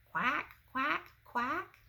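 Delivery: high, rising duck quacks. Shown as a demonstration. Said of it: "Can you quack like a duck?".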